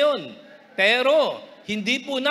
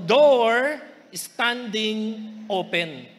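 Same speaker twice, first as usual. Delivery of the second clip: slow reading; much slower than usual. Acted. Reading scripture slowly.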